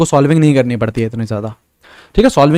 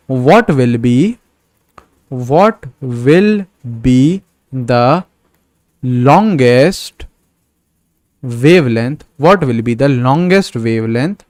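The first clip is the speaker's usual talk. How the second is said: word by word, very slow dictation; slow dictation of the exam question; slow question reading; much slower than usual. Shown as a demonstration. Reads the exam question aloud.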